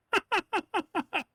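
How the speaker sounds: squealing giggle up high; high laugh. Real, not acted. Player delighted by the hat, high squeal of laughter. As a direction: let out a high gleeful giggle.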